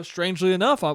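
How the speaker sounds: ordinary delivery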